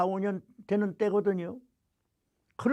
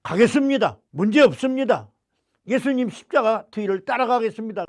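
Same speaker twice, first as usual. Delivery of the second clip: higher, louder eager vow; 4 semitones higher, 6 dB louder than usual. Retold or imitated. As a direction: promise it with fervor. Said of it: "그래서".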